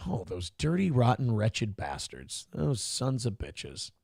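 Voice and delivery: low, slow muttered curses; hammed-up quiet fury; mock seething. Mock cursing the politicians.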